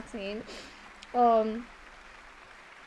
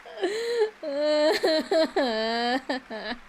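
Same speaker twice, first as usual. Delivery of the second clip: teary laugh-cry; 4 semitones higher than usual. Real, not acted. Crying after the emotional quest.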